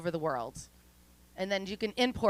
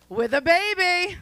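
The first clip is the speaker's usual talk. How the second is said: punchline; 10 semitones higher, 9 dB louder, slower than usual. Pretend. Punchline after the applause.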